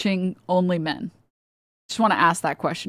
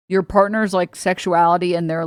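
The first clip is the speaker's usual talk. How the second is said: ordinary talk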